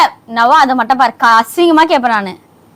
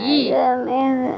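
feigned chest pain; 9 dB quieter, slower than usual. Acted. Wife fakes chest pain.

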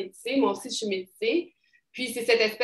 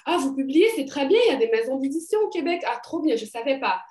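imitated patronizing surprise, pitched up; mock-amazed quote, higher voice; condescending; 4 semitones higher than usual. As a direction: act impressed as if talking down. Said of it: "cette espèce de condescendance assez incroyable".

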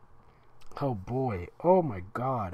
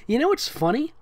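ordinary delivery

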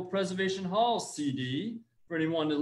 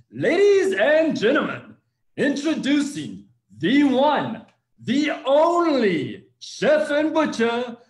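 booming slow ring-announcer intro, much higher and louder; hype man; 9 semitones higher, 9 dB louder, slower than usual. Pretend. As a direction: drag out the intro like a boxing announcer. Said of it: "I am an incredible hype man".

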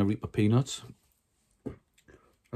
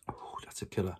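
whispering; 3 semitones lower, 11 dB quieter than usual. Real, not acted. Spicy food burning mouth.

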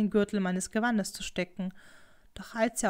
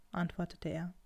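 plain reading voice

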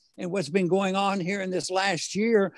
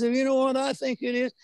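a hint of a German accent, pitched up; light German accent; 5 semitones higher than usual. Recalling his German teacher's compliment, lightly imitating German accent. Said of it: "he talked in his German accent".

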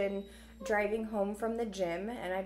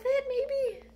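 tearful maybe; 13 semitones higher, 3 dB louder than usual. Real, not acted. Unsure, tearful wrap-up.

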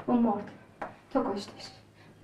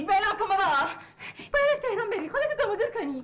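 high, loud mocking echo with a laugh; 8 semitones higher than usual. The woman mocks the man's orders back at him, loud and scornful. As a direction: throw his words back at him, laughing. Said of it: "صداتو بیار پایین".

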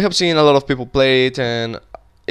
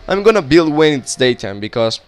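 ordinary talk

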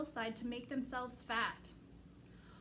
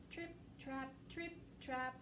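very quiet hoofbeats; whisper-soft trip trap; 7 dB quieter than usual. A narrator's reading. Hoof sound second goat.

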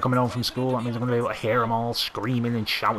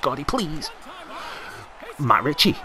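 ordinary talk

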